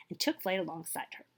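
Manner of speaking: ordinary delivery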